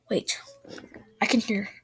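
ordinary delivery

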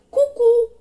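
bright mock clock chime; clock cuckoo. Clock sound imitated.

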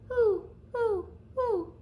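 high hooting owl call; playful owl sound for kids. Acted. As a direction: hoot it like an owl in the trees.

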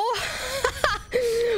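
high wailing laugh. Real, not acted. Streamer laughing at failing again. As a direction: laugh half in despair.

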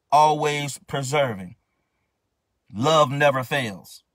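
emphatic pulpit declaration; preaching at full force. Acted. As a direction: drive each line home slowly. Preaching the love list.

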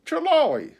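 doctor interrupting, raising his voice; doctor cutting in. A narrator's reading. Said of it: "said the doctor".

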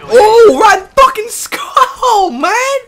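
high, slow laughing wail; laughing cry. Real, not acted. Loses round, laughing cry.

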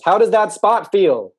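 loud, high, drawn-out shout across a distance; slow high-pitched holler to someone far off; calling out loudly. Acted. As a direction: call it out in all caps, stretching the words. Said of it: "It's all in caps".

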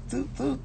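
small, high, soft toot-toot; car horn imitation. Mocking other people's horns, high soft toots. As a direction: toot like a feeble little horn. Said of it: "y'all horn sound like that song".

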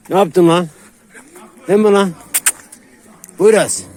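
high, loud, gushing praise for the dog; excited dog praise. Real, not acted. Owner gushing over his dog, high and loud. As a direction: hype the dog up like it just did something great.

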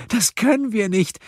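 high tearful protest; sobbing. A narrator's reading. Woman begging friends not to choose, sobbing. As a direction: plead through sobs. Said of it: "schluchzte Silje".

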